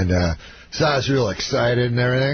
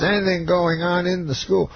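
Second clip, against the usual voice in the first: lightly mimicked, pitched up; a hint of the star's higher voice; Bill Cosby; 6 semitones higher than usual. Star asks backstage for local news, lightly mimicked. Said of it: "He says".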